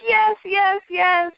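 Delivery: high-pitched repeated cheer; excited cheer. Retold or imitated. Fans reliving Pride coming out, excited cheer. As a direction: cheer it high, each yes punched out.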